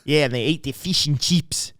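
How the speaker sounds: put-on light Australian accent on "fish and chips". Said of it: "That's how they say their eyes".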